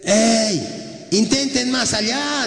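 high, slow call across the water; the Lord calling. Acted. The Lord calls from the shore to the fishermen, high slow call.

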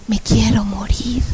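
slow, whispered despair; despairing echo. Retold or imitated. Woman echoing demons' death wish, despairing whisper. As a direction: breathe it out as if giving up. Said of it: "empiezo a escuchar mi voz".

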